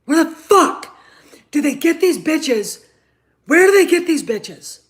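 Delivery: high, loud raging question; furious. Real, not acted. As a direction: yell it in disgust. Raging at the writers.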